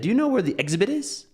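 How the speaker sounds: quick, high question with "exhibit" stressed on its first syllable. Retold or imitated. Friend asks at the museum desk, "exhibit" stressed on first syllable. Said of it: "she stressed the first syllable".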